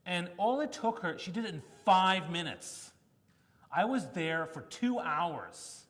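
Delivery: ordinary talk, nothing marked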